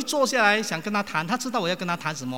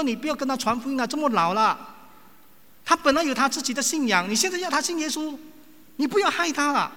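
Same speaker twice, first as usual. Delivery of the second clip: higher, louder upset protest; agitated relatives; 9 semitones higher, 4 dB louder than usual. Retold or imitated. Family on the phone scolding the preacher, upset and higher. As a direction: play the family's heated objection. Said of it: "他说：".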